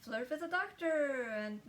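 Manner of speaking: lightly mocked giddy flirt, starting high; playfully flirty.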